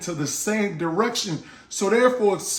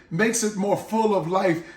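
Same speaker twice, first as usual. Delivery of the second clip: ordinary talk, nothing marked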